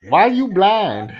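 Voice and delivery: high, loud, drawn-out Puerto Rican imitation; Puerto Rican accent. Puerto Rican lady teasing a man, high put-on accent. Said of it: "the Puerto Rican voice he was doing".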